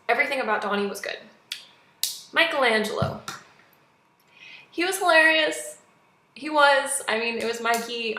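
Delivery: ordinary talk, nothing marked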